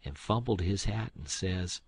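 ordinary delivery, nothing marked

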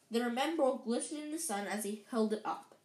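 ordinary delivery, nothing marked

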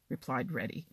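ordinary delivery, nothing marked

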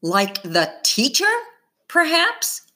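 sharp rising perhaps; a cutting, rising jab; pointed sarcasm. A narrator's reading. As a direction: needle them with the question. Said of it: "Her voice could crush bricks".

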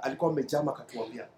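ordinary talk

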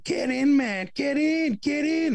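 very high, loud American yell; hammed-up Americans calling from shore; American impression. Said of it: "I love your American impression".

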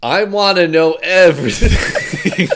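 laughing, drawn out. Real, not acted. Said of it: "Hey, that worked".

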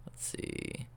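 soft whisper to himself; murmured whisper while scanning the page; whispered aside. Real, not acted. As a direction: breathe it out under your breath. Whispers while finding his place.

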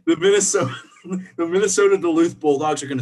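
laughing through the restart, then settling. Real, not acted. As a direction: giggle out the first words, then push on. Laughing while restarting sentence.

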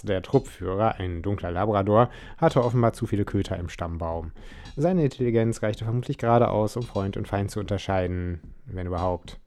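usual speaking voice

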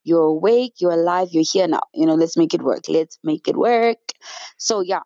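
ordinary talk, nothing marked

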